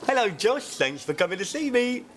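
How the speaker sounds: bright kiddy greeting. Real, not acted. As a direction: greet the little fan brightly.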